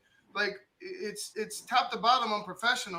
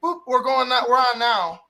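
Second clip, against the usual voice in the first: louder, mock pissed-off retort; imitating fed-up colleague; 9 dB louder than usual. Fed-up booth worker secretly switching on the mic, mock pissed-off. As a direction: act out someone flipping the mic on, loudly. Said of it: "might have been pissed off".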